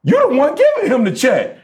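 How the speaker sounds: 思わず声が裏返るほど呆れて高く叫ぶ; incredulous inner rant. Retold or imitated. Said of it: "I'm thinking to myself".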